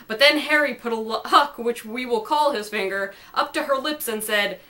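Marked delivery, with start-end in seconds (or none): none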